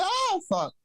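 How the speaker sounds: high mock panic scream. Reacting to the bed bug picture, high mock panic scream. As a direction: squeal it like you're panicking, hammed-up. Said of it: "I wanna scream like a bitch".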